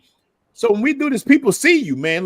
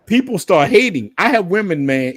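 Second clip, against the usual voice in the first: ordinary delivery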